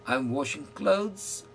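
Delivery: very slow, each word drawn out; slow model sentence. Shown as a demonstration. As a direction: say it slowly for learners to repeat. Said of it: "So you will say".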